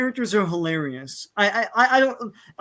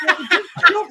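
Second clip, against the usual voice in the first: laughing retort; 9 semitones higher, 6 dB louder, faster than usual. Retold or imitated. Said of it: "It's like".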